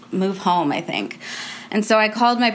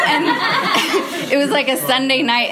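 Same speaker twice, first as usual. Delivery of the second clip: laughing; 6 semitones higher than usual. Real, not acted. Laughing while telling.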